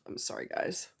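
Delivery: whispered apology, fighting tears; tearful whisper. Real, not acted. Breaking down mid-story, whispering sorry to viewers. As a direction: breathe it out, near crying. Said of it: "I don't know why I'm so emotional".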